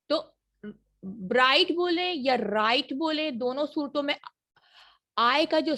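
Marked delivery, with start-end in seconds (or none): none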